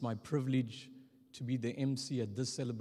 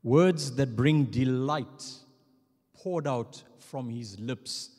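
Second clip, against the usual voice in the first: rising, emphatic tribute; preaching eulogy; 5 dB louder than usual. Acted. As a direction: build it like a sermon.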